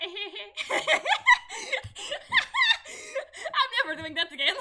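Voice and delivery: embarrassed laughing. Real, not acted. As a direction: laugh through the words. Embarrassed laughing after acting.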